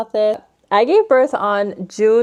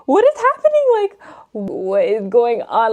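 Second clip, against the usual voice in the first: panicked disbelief; 4 semitones higher than usual. Retold or imitated. Panicked quote in shower.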